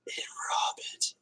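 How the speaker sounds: whispered, cheeky and sly; suggestive whisper. Pretend. Streamer jokingly whispers a naughty aside to chat. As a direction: murmur it like a naughty secret.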